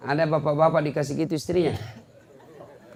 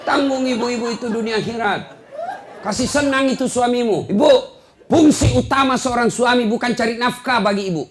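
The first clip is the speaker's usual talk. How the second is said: fiery preaching; 9 semitones higher, 6 dB louder than usual. Real, not acted. Preacher lecturing wives on sin, fiery. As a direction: preach it out to the back row.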